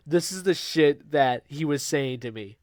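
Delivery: ordinary talk, nothing marked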